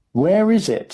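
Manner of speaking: high, loud call from a crowd; shouting. A narrator's reading. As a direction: shout the question eagerly, pitched high. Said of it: "someone shouted".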